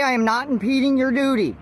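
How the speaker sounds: ordinary talk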